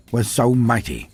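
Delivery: normal talking voice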